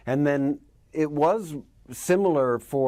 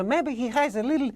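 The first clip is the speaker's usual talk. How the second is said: squeaky high goat voice try-out; trying a higher voice; 12 semitones higher than usual. Shown as a demonstration. Actor testing a high goat voice in the studio, squeaky high. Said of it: "we played around with some higher voices".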